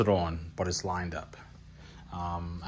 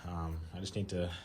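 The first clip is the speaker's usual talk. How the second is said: out of breath; 2 semitones lower, 11 dB quieter than usual. Real, not acted. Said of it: "I'm sorry I'm out of breath".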